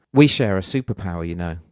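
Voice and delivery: ordinary delivery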